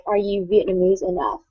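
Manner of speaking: ordinary talk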